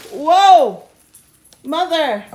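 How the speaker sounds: loud, high cry of alarm; startled yell. Real, not acted. Vlogger as groceries crash, startled yell. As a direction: yelp out as things fall. Items crash down while filming.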